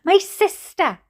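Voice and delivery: high, loud theatrical wail; mother's wail. A narrator's reading. Mother searching the hospital for her sister, wailing. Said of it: "wailed my mother again".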